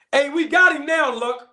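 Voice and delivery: high, loud triumphant call; gotcha. Real, not acted. Host catching rival Blue Blood in a claim, triumphant and loud. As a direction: crow over catching him out.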